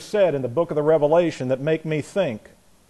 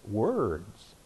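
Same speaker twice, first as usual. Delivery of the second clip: quiet repeat; 7 semitones lower, 10 dB quieter than usual. Real, not acted.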